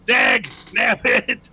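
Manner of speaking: "Dag, damn it" stretched to half speed; drawn-out mock curse. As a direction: draw out each word in mock exasperation. Repeats the mock curse, escalating.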